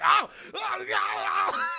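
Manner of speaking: high, loud wordless hollering; hammed-up frantic attack cries; acted fighting yells. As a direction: holler wildly as if pummelling someone. Acting out frantic pummelling.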